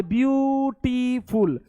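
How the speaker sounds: "ब्यूटीफुल" very slow, each syllable held long. Shown as a demonstration. Teacher showing pupils it takes several beats, "ब्यूटीफुल" drawn out. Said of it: "हम क्या बोले?".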